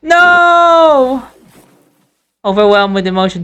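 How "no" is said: miserable shout. Acted. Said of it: "he shouts out all miserable".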